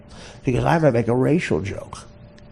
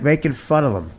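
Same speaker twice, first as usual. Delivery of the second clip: ordinary talk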